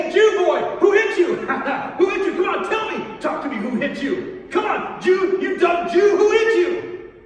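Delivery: high, loud jeering with a cackle; bully him like a sneering soldier; cruel taunting. Acted. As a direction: soldier jeering at the blindfolded prisoner, loud and mocking. Soldiers mock the blindfolded prisoner.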